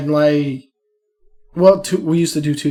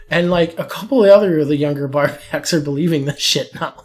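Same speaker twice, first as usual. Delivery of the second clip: usual speaking voice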